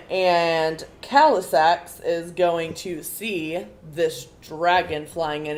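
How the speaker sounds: normal talking voice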